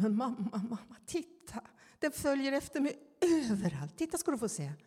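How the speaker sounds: high, pleading mock child voice; imitating tearful child. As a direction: plead to mum like a frightened little kid on the verge of tears. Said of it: "med gråten i rösten säger". (härmar ett barn med gråten i rösten)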